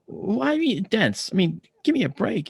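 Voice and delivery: high-pitched, exasperated comeback; indignant retort. Retold or imitated. Kidnapped patient answering being called dense, indignant.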